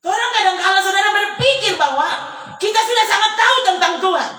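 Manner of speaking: loud and high, driving the lesson home; fervent sermon climax; preaching at full force. Acted. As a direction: proclaim it to the congregation. Preacher drives home the point.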